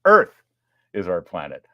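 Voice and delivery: ordinary delivery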